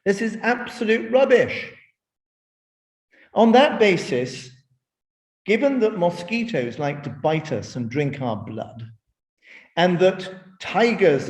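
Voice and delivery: put-on a boy calling out the grown-ups' nonsense; bold boy. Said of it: "a ten-year-old boy stands up and says".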